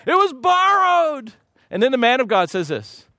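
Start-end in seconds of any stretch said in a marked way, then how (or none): 0.1-1.3 high, slow, whiny repeat; mocking whine. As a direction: mimic the man's pitiful complaint. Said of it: "You know".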